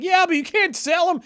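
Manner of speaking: high-pitched exasperated objection; indignant protest. Retold or imitated. Older brother protesting the sale, high indignant cry. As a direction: squeak it out in disbelief.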